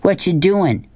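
lightly acted shout up the bus; mild shout. Norton questions the driver from his seat, mild shout. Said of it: "Norton shouted".

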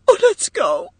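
high-pitched, pleading wail; upset woman's high voice; distressed cry. A narrator's reading. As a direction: cry it out, desperate to leave. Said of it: "cried Edith".

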